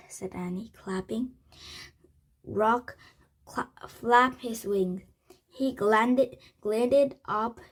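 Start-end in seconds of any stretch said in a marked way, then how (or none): none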